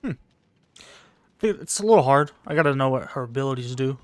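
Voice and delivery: ordinary talk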